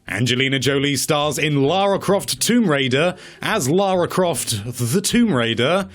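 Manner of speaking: ordinary talk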